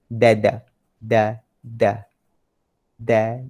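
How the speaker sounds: ordinary talk, nothing marked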